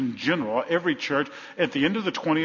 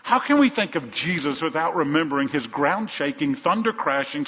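ordinary talk, nothing marked

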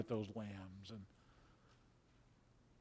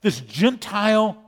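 loud, fervent preaching, higher and louder; preaching at full force; 5 semitones higher, 7 dB louder than usual. Acted. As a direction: drive the story home to the congregation.

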